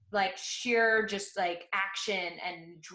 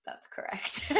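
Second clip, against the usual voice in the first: breathy laugh through the words; can't hold back a laugh; laughing while quoting mom. Real, not acted. Mom's deadpan answer retold.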